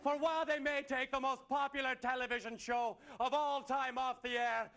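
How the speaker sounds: ordinary talk, nothing marked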